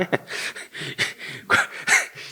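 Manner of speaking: amused laughter at own joke; real laugh. Real, not acted. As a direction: laugh it off for a couple of seconds.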